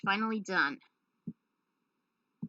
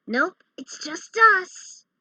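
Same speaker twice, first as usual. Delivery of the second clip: 1 cocky reply; 11 semitones higher, 10 dB louder, slower than usual. Acted. Character answers the heroes.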